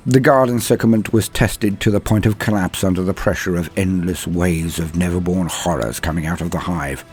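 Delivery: usual speaking voice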